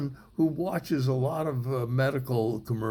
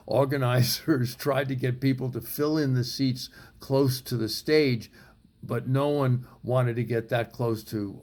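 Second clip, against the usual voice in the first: ordinary talk, nothing marked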